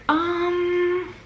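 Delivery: high, held "um" of stalling; drawn-out um. Retold or imitated. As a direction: stall on one long high note. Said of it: "going like that".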